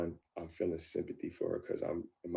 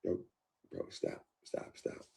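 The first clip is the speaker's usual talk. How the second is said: soft, breathy plea to stop; hushed pleading; 8 dB quieter than usual. Retold or imitated. Peacemaker hushing the couple in a soft, breathy plea. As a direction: quietly try to break up the fight. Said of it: "trying to be a peacemaker".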